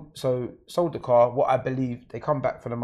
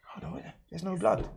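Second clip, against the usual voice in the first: puzzled; 7 semitones higher, 4 dB quieter than usual. Retold or imitated. His puzzled thought when shot.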